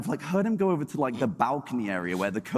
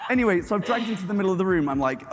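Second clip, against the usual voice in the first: ordinary talk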